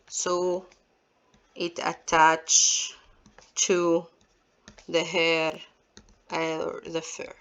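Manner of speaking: slow word-by-word dictation with long pauses; measured, level delivery, each word set apart; dictating. Shown as a demonstration. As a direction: dictate for note-takers, one word at a time. Dictates answer while writing it.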